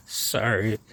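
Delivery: usual speaking voice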